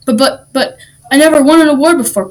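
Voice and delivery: stammer, then whine it out; whining. A narrator's reading. Patrick wants the award for himself, whining. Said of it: "Patrick whined".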